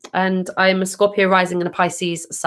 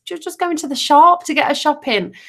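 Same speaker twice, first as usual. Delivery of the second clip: ordinary talk, nothing marked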